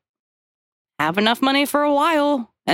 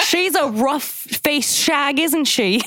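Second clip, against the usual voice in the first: halting mock Australian accent, slow, breaking into laughs; a fumbling Australian accent through giggles; 4 semitones higher, slower than usual. A mock Australian accent, mocking the sex worker. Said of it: "I'm trying to do an Australian accent".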